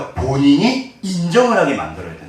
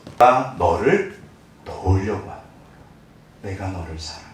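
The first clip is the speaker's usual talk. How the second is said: tender; 6 semitones lower, 4 dB quieter than usual. Acted. God's gentle voice quoted.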